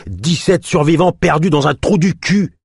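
high, loud, spitting hatred; hateful rage. Acted. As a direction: spit it with hate. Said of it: "presque haineuse".

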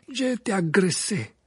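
high, loud character voice; aggrieved Krum. Acted. Krum answers Karkaroff about the attack, aggrieved.

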